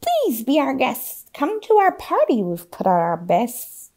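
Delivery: lightly voiced witch, swooping high; a hint of a sly hostess. A narrator's reading. Witch invites the children to her party, lightly voiced. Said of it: "said the witch".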